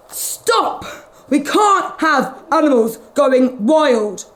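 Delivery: firm vet call; stern vet. Acted. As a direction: put a stop to it. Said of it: "said the vet".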